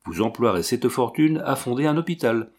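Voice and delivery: usual speaking voice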